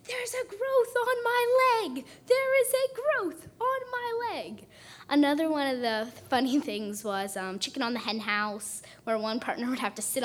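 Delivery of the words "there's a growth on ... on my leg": slow, very high, loud cry, every word stretched out; drawn-out high mock wail of alarm; mock yell. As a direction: call it out slowly in a silly high voice.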